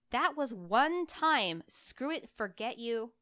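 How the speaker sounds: loud, high, slow protest; indignant outburst. Acted. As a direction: bristle and snap back.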